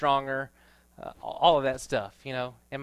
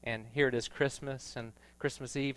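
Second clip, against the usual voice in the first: usual speaking voice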